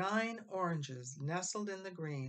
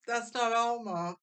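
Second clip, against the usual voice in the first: sobbed; 6 semitones higher, 5 dB louder than usual. Acted. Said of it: "he sobbed".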